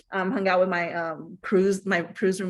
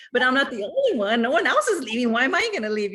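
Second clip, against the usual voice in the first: higher and louder, pleading not to leave; insistent protest; 6 semitones higher, 5 dB louder than usual. Retold or imitated. To her husband who wants to leave, insistent raised protest. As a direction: argue your case, voice raised. Arguing with husband about leaving.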